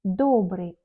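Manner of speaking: "Добрый" with a full, stressed first o. Shown as a demonstration. Teacher showing that accented O stays O, stressed O. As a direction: hit the o in "Добрый". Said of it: "O accentué, on prononce comme O".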